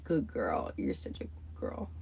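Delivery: low, hushed praise; soft pet praise. Real, not acted. To her cat after a treat, hushed tender praise.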